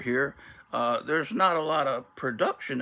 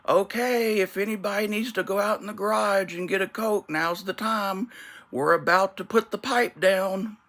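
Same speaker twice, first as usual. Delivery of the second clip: much higher, loud household announcement; announcing loudly; 9 semitones higher, 3 dB louder than usual. Retold or imitated. Parent warning the family before blocking the garage door, loud high call. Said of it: "my dad or my mom would be like".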